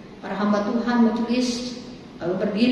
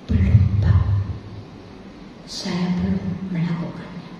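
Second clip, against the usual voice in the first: ashamed whisper; 4 semitones lower than usual. Retold or imitated. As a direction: admit it under the breath, ashamed. Ashamed member admits quietly.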